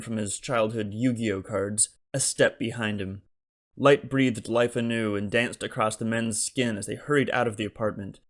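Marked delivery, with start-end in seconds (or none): none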